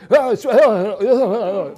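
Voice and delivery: high, loud flustered oh oh; mock panic. Pastor imitating the demons freaking out at Jesus, high and loud. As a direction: squeal like cornered demons. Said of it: "he just walks up and they go".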